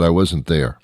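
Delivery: ordinary talk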